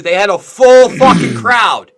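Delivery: loud, slow, furious shout; outraged shout. Real, not acted. Host ranting to John, loud slow shout. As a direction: bellow it in disbelief. Outraged about full arena crowd.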